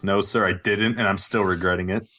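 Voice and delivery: ordinary delivery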